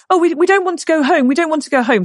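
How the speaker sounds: high-pitched imitated protest; pleading children. Girls threatened with going home, imitated high pleading. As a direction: mimic kids begging to stay.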